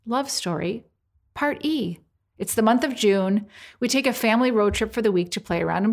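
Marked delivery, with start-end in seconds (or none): none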